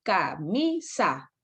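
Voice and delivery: "Camisa" split slowly into ca, mi, sa; syllable by syllable. Shown as a demonstration. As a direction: sound out "Camisa" like a child learning to read. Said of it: "pronuncio en un solo golpe de voz".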